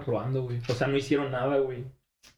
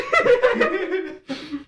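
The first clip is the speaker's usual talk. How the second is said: loud laugh; 6 dB louder than usual. Real, not acted. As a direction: burst of high laughter. Big laugh.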